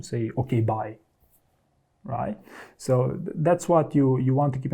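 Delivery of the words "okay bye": curt goodbye. Shown as a demonstration. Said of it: "completely different from".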